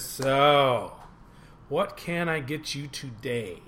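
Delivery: drawn-out, slow bartender's question; strained patience. Acted. Bartender faces the pestering duck on another day, strained patience. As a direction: stretch each word with laboured patience.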